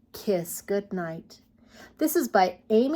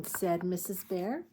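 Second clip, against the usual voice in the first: ordinary delivery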